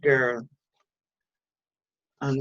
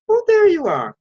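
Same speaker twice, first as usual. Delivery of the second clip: much higher, louder, affected mum voice; funny posh voice; 14 semitones higher, 8 dB louder than usual. A narrator's reading. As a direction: put on a high, posh "found you" trill. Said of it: "She was talking in a funny voice, higher than usual and sort of posh".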